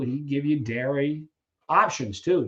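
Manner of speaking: usual speaking voice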